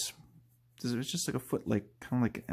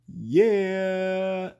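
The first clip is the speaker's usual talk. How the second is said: excited; 10 semitones higher, 4 dB louder than usual. Real, not acted. Cheering over a silly toy.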